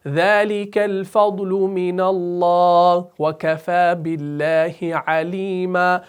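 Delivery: slow, chanted tilawat; slow measured Quranic chant; Quran recitation. Shown as a demonstration. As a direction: recite melodically and slowly. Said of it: "recites verse as example".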